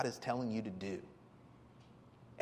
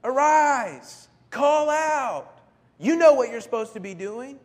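loud, higher commanding shout; captain's command; 8 semitones higher, 10 dB louder than usual. Retold or imitated. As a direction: bark the order like the ship's captain. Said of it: "Exact same Hebrew word".

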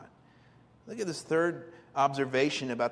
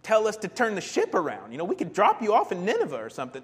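protesting sailors; 5 semitones higher, 5 dB louder than usual. Retold or imitated. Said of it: "they're like".

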